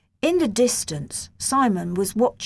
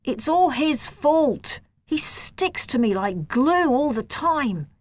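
slow, higher sulky grumble; grumbling; 6 semitones higher than usual. A narrator's reading. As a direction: sulk it out like a wronged kid. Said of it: "I grumbled".

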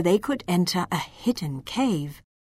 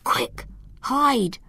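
urgent stage whisper; 4 semitones higher than usual. A narrator's reading. Elf warns the girl of danger, urgent whisper. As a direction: whisper a hurried warning. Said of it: "placed a finger on his lips and whispered".

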